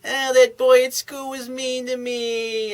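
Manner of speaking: high, drawn-out childish mock wail; crying little girl. Little girl complaining to daddy about school, mock crying. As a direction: put on a slow, whiny little-girl voice. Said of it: "little girl that sits in her daddy's lap crying".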